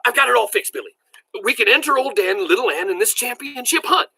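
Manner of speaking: high-pitched, eager rush of news; talking excitedly. A narrator's reading. Grandpa telling Billy the hunt is fixed, excitedly. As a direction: brim over with excitement. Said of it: "Talking excitedly".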